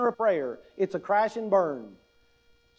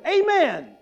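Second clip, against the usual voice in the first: exclaimed amen; 7 semitones higher, 4 dB louder than usual. Retold or imitated. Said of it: "she went".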